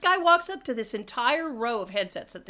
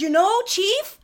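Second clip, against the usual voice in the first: much higher, loud, eager prompting; excited prompting; 11 semitones higher, 6 dB louder, slower than usual. Retold or imitated. A man helping his confused friend get the Halo reference, excited. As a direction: nudge him with the obvious name. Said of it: "he says".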